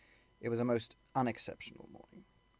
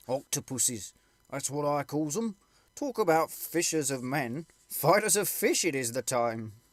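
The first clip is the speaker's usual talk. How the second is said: pipe-smoking soldier; 3 semitones higher, 4 dB louder, slower than usual. Acted.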